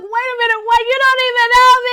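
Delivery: high, loud, wailing mock protest; comically outraged, rapid yell at the end; indignant outburst. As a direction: cry out as if deeply wounded, hammed-up. Acted comeback to a rude stranger.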